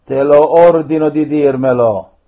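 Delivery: loud, forceful orders; stern command. Real, not acted. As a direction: command it to speak. Priest commanding a spirit.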